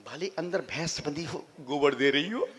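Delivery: ordinary talk, nothing marked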